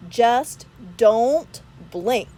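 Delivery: slow, loud drawn-out warning. Acted. Arthur warning Stanley, slow drawn-out warning. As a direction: stretch it out as a warning.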